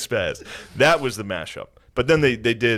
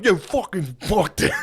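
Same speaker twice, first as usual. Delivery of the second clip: higher, fed-up curse; mock angry rant; 8 semitones higher than usual. Comics in the car after bombing, a high fed-up curse. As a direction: vent like a sore loser. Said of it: "They were in the car like".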